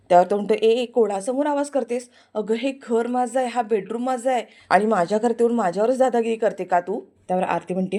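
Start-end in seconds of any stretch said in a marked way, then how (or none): 0.5-7.0 angry threat. Acted. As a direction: lay down that it's your house. Prithvi asserts his house.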